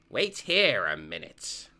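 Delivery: calm dad instruction; Joseph voice. Acted.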